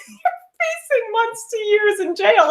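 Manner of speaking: high, laughing delivery of grim news. Retold or imitated. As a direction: giggle out the sentence. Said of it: "We're literally laughing".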